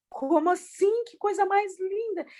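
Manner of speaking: high-pitched, acted wonder; retold amazement. Mother overhearing her son at swimming, retold in high-pitched amazement. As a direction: imitate a moved, astonished reaction.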